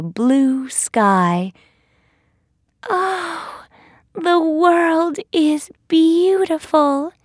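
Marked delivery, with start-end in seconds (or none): 2.9-7.1 very high, wondering heroine voice; delighted cry. A narrator's reading. Thumbelina seeing the world from the sky, high delighted cry. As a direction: marvel at it high up in your range. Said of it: "cries Thumbelina".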